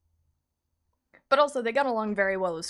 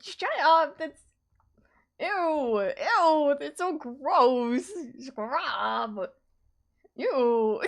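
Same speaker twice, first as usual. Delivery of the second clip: flustered; 7 semitones higher, much slower than usual. Real, not acted. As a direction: squeal in mock disgust. Flustered by compliment.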